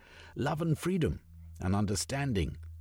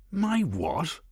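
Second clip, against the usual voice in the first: startled; 9 semitones higher, 4 dB louder than usual. Acted. Blackanor startled by accusation.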